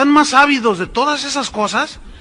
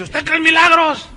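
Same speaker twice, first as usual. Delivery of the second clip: mock televangelist asking loudly, higher; hammed-up stage preacher; showy preacher; 10 semitones higher, 6 dB louder than usual. Preacher voiced mocking the showman. (imitando a un predicador de espectáculo)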